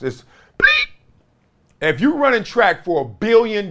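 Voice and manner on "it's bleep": very high imitated whistle; whistle sound. Shown as a demonstration. Ex-player showing a referee's whistle, high imitation. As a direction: mimic the ref's whistle. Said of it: "there's two whistles".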